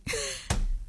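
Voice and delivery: soft breathy chuckle; brief laugh. Real, not acted. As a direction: let a small laugh slip out. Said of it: "I'm laughing".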